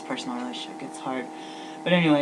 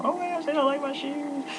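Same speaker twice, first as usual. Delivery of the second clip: much higher, whiny fake wail; mock-crying over insulted shoes; 13 semitones higher than usual. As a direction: ham up a sobbing complaint. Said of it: "You're crying, calling out".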